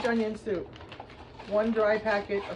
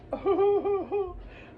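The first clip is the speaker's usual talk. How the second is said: high laugh; 12 semitones higher, 4 dB louder than usual. Real, not acted. Laughing at own hunger remark.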